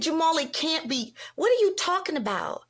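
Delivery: high-pitched retold protest; acted disbelief. Retold refusal to believe cancer news.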